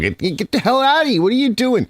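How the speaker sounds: retold yelling. Dog owner to the dog messing on its bed, mock yelling. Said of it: "I'm yelling at the dog".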